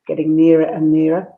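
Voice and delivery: ordinary delivery, nothing marked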